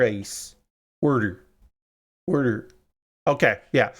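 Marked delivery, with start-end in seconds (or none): 1.0-1.4 Pittsburgh accent. Shown as a demonstration. Streamer practising Pittsburgh accent work, saying Pittsburgh "Water" as "wooder". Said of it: "a fairly okay Pittsburgh accent?". 2.3-2.7 Pittsburgh accent. Shown as a demonstration. Streamer practising Pittsburgh accent work, saying Pittsburgh "Water" as "wooder". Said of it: "a fairly okay Pittsburgh accent?".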